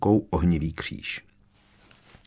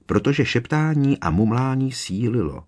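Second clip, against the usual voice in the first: ordinary delivery, nothing marked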